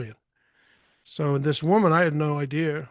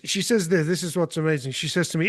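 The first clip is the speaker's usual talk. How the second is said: plain reading voice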